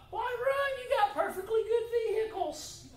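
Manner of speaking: very high laughing disbelief; amused disbelief. Retold or imitated. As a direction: tease the joggers, laughing. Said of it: "And I thought".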